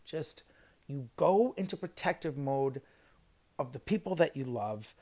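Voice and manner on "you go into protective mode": normal talking voice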